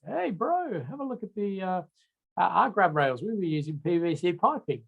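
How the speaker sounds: put-on a cheerful Kiwi local in an overdone New Zealand accent; bad New Zealand accent. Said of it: "That was my really bad New Zealand accent".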